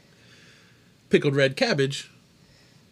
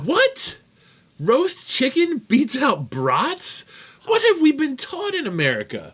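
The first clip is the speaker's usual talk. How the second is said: shocked disbelief; 12 semitones higher, 4 dB louder than usual. Real, not acted. Shocked at roast chicken answer.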